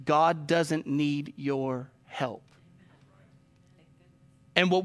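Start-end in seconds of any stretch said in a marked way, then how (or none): 0.0-2.4 very slow, every word weighed; a solemn point driven home; slow emphatic. Real, not acted. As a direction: let each word land on its own. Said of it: "I want you to really hear this".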